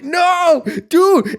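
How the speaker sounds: high, loud cry of disbelief; retold shout of amazed excitement; popping off. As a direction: imitate a starstruck fan yelling. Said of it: "He pops the fuck off".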